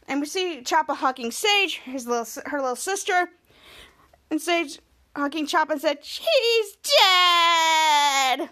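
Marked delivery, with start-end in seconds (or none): 6.0-8.5 put-on tearful, wailing child voice; crying. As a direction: sob it out through tears. The little sister crying in a hug.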